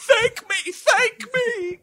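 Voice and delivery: very high, strained comic cry; mock pleading. Father at the tub demands thanks, high mock cry. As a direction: squeal it high as a hammed-up demand.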